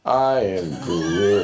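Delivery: very slow, low, each word stretched; drawn-out refusal. Real, not acted. Mock refusal to become a felon.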